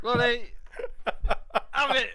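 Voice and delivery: high, loud exasperated laugh; laughing exasperation. Real, not acted. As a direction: fling the words out laughing. Laughing despair at own shot.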